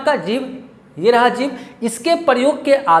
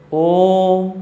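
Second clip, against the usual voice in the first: "ओम" held long on one level note; prolonged vowel; 3 semitones lower than usual. Shown as a demonstration. Teacher demonstrating the pluta vowel, prolonged vowel. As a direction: sustain the O of "ओम" like a chant. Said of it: "प्लुट स्वर का प्रयोग".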